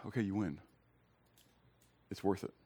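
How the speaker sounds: sigh, then give in softly; very soft, resigned retold concession; quiet surrender. Retells giving in to God.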